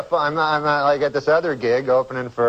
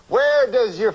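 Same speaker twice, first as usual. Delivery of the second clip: Bill Cosby impression; 9 semitones higher, slower than usual. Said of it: "I don't even know if I can do Cosby".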